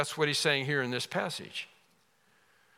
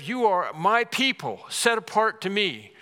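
preaching; 5 semitones higher, 5 dB louder than usual. Acted. Preacher quoting God to the church.

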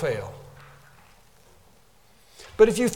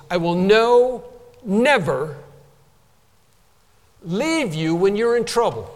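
"no" punched louder, "never" set apart; emphatic no, never; 3 semitones higher, 3 dB louder, slower than usual. Shown as a demonstration. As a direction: lean hard on "no" then "never". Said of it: "and again it is".

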